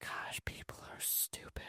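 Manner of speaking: under-the-breath whisper; whispered aside. Retold or imitated. Whispering his scorn at the breakup drama. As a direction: breathe it out as a private jab.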